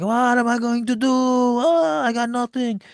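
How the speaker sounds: high, drawn-out wail imitating a man losing it; mock upset. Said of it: "So he starts getting mad".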